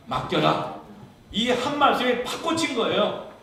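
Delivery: sudden loud, high outburst; forceful preaching. Real, not acted. Preacher on the one word that struck home, forceful preaching. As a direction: burst out as the word strikes home.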